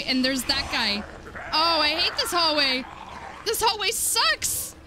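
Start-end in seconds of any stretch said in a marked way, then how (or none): 1.5-4.7 high wail, climbing to the end; distressed whine. Real, not acted. Player stuck in hated hallway, distressed whine. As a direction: wail it out in dread.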